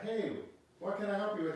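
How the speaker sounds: high, bright divine helper voice; cheerful voice of God answering. Acted.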